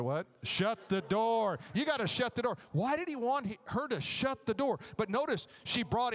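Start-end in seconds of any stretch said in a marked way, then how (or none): 0.4-1.6 said together. Real, not acted. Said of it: "let's say that together".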